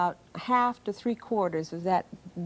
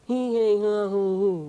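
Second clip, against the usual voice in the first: nasal vowels; 3 dB louder than usual. Shown as a demonstration. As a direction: open nasal port, hollow vowels. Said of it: "You can get a sound that sounds like this".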